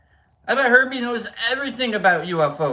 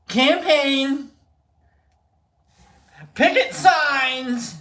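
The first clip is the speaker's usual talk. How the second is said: calling out, raised pitch; mock rallying call; 6 semitones higher, 4 dB louder, much slower than usual. Host rallying fans for a protest, mock calling out. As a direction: drag out each rallying word.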